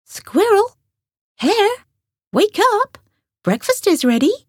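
high calling voice up the stairs; Grey Rabbit calling Squirrel and Hare down to breakfast, high call. A narrator's reading. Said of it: "called Squirrel and Hare".

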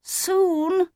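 very high, small squeak. A narrator's reading. Otto hearing it will soon be cold, squeaking. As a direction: squeak the word as a startled question. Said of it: "squeaked Otto".

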